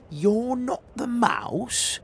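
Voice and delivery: put-on high, slow questioning child voice; puzzled child. Gruffalo's child asking the fox, puzzled.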